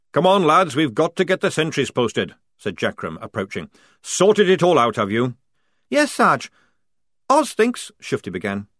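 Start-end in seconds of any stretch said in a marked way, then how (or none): 0.1-5.4 loud, higher barking sergeant; bellowing sergeant. A narrator's reading. Jackram ordering the squad, loud sergeant bark. 5.9-7.9 higher, louder, doubtful; high questioning voice. A narrator's reading. Tonka questioning Polly, high and doubtful.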